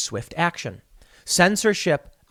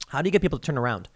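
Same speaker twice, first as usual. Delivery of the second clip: usual speaking voice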